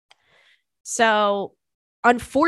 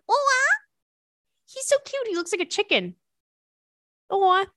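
high cooing over a cute pet, much higher; 12 semitones higher than usual. Real, not acted. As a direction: melt over the picture in a high coo. Cooing over a cat photo.

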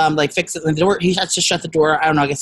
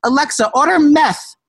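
put-on drunken screaming; 7 semitones higher than usual. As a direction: bellow orders like a drunk. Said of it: "I was screaming that night".

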